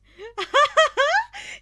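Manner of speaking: high, loud amused laugh. Acted. Monoma laughing at two strangers approaching, high and loud. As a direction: laugh at the newcomers.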